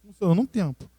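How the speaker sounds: sudden soft drop; falando baixinho. Shown as a demonstration. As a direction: fall away to a soft voice. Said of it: "eu falo baixinho". (cai pra voz baixinha)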